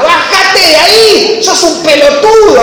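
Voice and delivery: lightly hammed-up angry order; cop yelling. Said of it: "le decía el cana".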